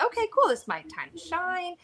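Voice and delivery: high-pitched, eager self-quote; upbeat inner voice. Retold or imitated. Thinking she finally gets to be in charge, upbeat. Retells hopeful thought on being in charge.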